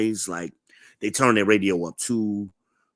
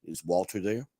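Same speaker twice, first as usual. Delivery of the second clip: dad's voice; 3 semitones lower, 9 dB quieter than usual. Retold or imitated. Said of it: "He showed up to our room".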